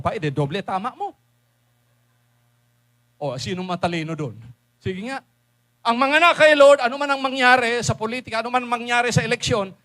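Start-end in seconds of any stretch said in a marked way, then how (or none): 5.8-9.7 louder, higher preaching proclamation; full-force sermon climax; triumphant shout. Real, not acted. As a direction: declare victory to the crowd. Preacher's closing declaration.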